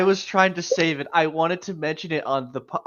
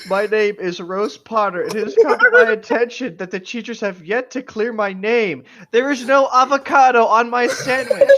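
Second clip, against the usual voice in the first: mock proclamation; 4 semitones higher, 7 dB louder than usual. Mock Rose Potter proclamation.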